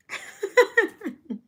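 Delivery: bright high burst of laughter. Real, not acted. Laughs about low energy.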